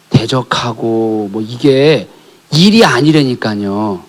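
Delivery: ordinary talk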